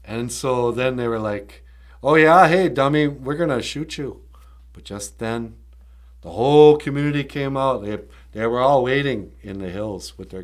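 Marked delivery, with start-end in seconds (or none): 2.0-4.2 loud, higher taunting threat; mock threat. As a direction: call out a hammed-up warning. Acted threat from the agents.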